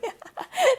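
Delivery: loud squealing laugh; high burst of laughter. Real, not acted. Amused by her own bad impression, loud high laugh.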